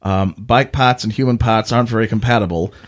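normal talking voice

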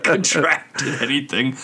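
laughing, slow mock of "Contracted anything"; mocking repeat. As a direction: echo "Contracted anything" slowly through a laugh, teasing. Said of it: "That's not what you call that".

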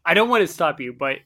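ordinary talk

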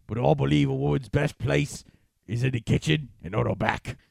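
lightly voiced British accent, a hint of an old British man; old British man impression. Reading Tyson Fury quotes in a lightly voiced British accent. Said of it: "he sounds like an old British man".

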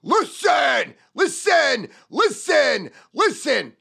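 loud, high repeated listen; shouted listen. Shown as a demonstration. Salesman shouting listen at a customer. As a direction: bark listen to grab attention. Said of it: "One word".

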